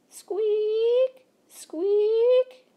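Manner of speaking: high loud squeak of a pump; squeaky pump. A narrator's reading. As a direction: squeak like a rusty pump handle. The pump handle.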